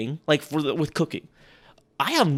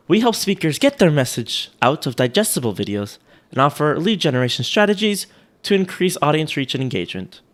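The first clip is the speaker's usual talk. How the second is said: mock British read, a bit louder; attempted German accent slipping into British; British Siri accent; 5 dB louder than usual. Shown as a demonstration. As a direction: recite it like a polite British voice assistant. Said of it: "British Siri".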